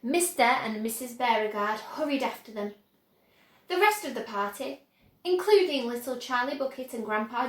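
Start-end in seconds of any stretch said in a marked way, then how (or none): none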